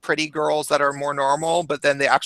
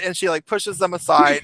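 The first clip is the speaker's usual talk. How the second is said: ordinary delivery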